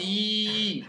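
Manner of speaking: I held long and steady; long vowel. Shown as a demonstration. Teacher reminding a pupil of the long vowel, held out. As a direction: draw the vowel "i" right out. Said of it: "c'est la voyelle longue de ya".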